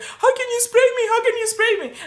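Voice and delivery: high, aggrieved mimicry of the sprayed cop; mock outraged cop; whining cop.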